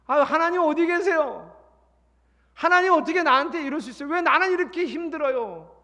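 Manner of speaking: high, loud complaining plea; complaining cry to God. Retold or imitated. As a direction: wail at God in mock distress. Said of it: "이렇게 말하지 않고". (하나님께 불평하며 높고 크게 외치기)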